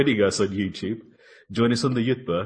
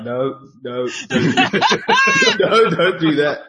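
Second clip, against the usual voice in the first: laughing warning; 7 semitones higher, 8 dB louder, slower than usual. Retold or imitated. Retold friend warning, amid laughter.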